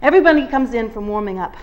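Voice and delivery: ordinary delivery, nothing marked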